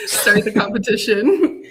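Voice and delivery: talking through real laughter; laughing. Real, not acted. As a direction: say it while still laughing. Laughs at the badge craze.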